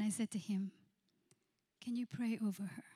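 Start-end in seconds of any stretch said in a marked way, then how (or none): 1.8-2.8 soft whisper, asking gently; whispered request. Retold or imitated. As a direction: whisper the question to the groom. Pastor asks groom to pray.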